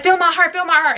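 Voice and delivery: panicked begging to check her heartbeat; frantic plea. Retold or imitated. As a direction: push him to notice, panicking. Begging brother to feel heart.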